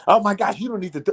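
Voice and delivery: high, mock flustered woman; lightly voiced prim caseworker; imitating the woman. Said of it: "CPS woman voiced".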